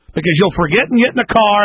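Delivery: ordinary delivery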